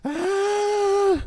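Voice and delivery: sudden high yelp; a sharp startled cry. Real, not acted. Startled while being shaken.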